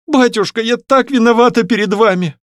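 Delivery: high-pitched, guilty plea to father; tearful. A narrator's reading. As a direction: daughter on the verge of tears. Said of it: "Она залилась слезами". (виновато, со слезами в голосе)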